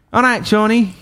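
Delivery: teasing Wakefield workmate. Retold or imitated. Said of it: "he was from Wakefield".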